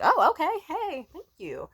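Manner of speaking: very high squeal dropping into thanks; delighted mock reply. Accepting boyfriend's offer of vitamins, high-pitched and playful.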